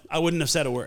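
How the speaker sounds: usual speaking voice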